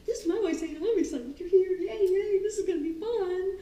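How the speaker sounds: high excited dog voice; voicing the dog. Jumping dog greeting the trainer, high excited. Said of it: "The dog is like".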